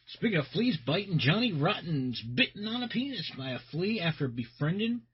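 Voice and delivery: ordinary talk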